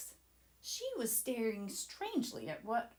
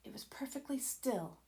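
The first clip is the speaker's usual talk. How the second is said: unvoiced, tense hush; scared whisper; 2 semitones higher, 6 dB quieter than usual. A narrator's reading. Leo describing the staring eye, whispering. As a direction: whisper as if the eye can hear.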